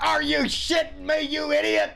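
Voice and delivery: loud, high, drawn-out heckle; yelling out. Acted. Heckler interrupting a speaker from behind him, outraged mock yell. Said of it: "say something and yell out".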